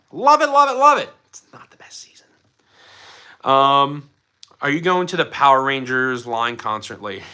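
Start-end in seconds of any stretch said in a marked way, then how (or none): 0.1-1.1 high, loud gushing repetition; love it, love it, gushing high and loud. Real, not acted. Gushing about Turbo. 1.3-2.3 a conspiratorial whisper; sneaky whispered admission; whispered aside. Pretend. Secret aside after gushing.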